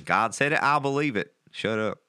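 emphatic preacher with a Southern twang. Acted. Mocking the saying as pulpit talk, Southern preacher voice. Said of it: "sounds like a Southern preacher saying".

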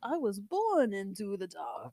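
slow, drawn-out villain impression; mock Bane. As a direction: intone it like a theatrical British villain. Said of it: "give us a Bane with a".

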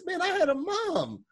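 mock Jax complaint. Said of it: "He says".

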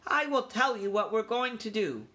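put-on high, assured young mouse; clear-headed young leader. A narrator's reading. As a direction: announce the plan with authority. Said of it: "he felt calm and clear-headed".

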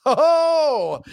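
high, loud "ho, ho" chuckle; jolly laugh. Real, not acted. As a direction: let out a big jolly ho-ho. Joke about spirits.

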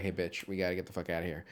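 quick, soft, low mock urging; brisk urging. Host voices the rescuer telling her to leave, brisk urging. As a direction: toss it off as a hurried rescue line. One of two imagined greetings.

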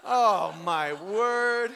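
loud, drawn-out dismay; exaggerated exasperation. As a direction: stretch each word out in mock horror. Exasperated at the family meeting.

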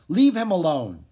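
loud protective order, pitched high; commanding. A narrator's reading. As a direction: warn him off with force. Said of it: "he ordered the man".

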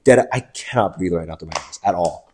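usual speaking voice

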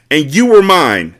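loud, slow yell. A narrator's reading. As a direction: yell it back at him. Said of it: "Carter yelled back".

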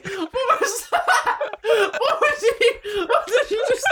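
high, laughing disbelief. Real, not acted. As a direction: squeal it through laughter. Laughing at choking friend.

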